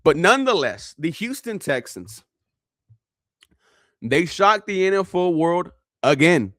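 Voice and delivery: normal talking voice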